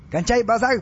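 high, loud rebuke; sharp shout. Acted. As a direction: snap at them to stop chattering. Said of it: "прикрикнул Неменхотеп". (прикрикнув, властно)

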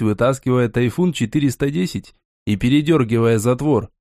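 plain reading voice

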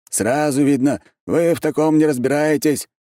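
high, dismissive retort; scornful. A narrator's reading. Архимаг dismisses the narrator's theory, scornful. Archmage scorns the hero's question.

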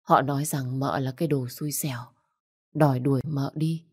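ordinary delivery, nothing marked

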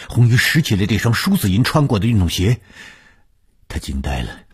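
ordinary delivery

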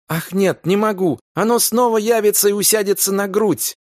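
a terrified plea, nearly a cry; voice breaking into a cry. Acted. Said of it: "Её голос сорвался на крик".

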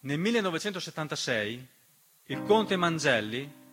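normal talking voice

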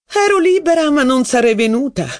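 a hint of a proud old marquise; lightly haughty retort. A narrator's reading. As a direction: answer with mild disdain. Marquise's cutting reply.

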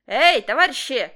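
loud call from the gate; loud hailing shout. A narrator's reading. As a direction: call out across the fence. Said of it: "послышалось вдруг".

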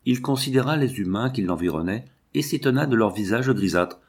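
ordinary delivery, nothing marked

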